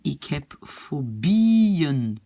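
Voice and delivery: "ik heb fobieën" slow, swelling on the long BIE; accent sur le I. Shown as a demonstration. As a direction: hold the BIE of "fobieën", then a light -en. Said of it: "l'accent est sur le I". (« ik heb fobieën » lent, BIE allongé et accentué)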